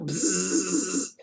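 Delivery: electric buzzing noise; mock zap sound. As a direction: buzz like a zap.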